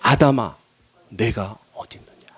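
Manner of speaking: put-on seek a lost child tenderly; gentle calling. God gently seeks hiding Adam.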